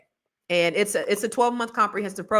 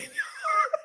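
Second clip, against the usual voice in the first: high giggle; 4 dB quieter than usual. Real, not acted. Laughs at getting distracted.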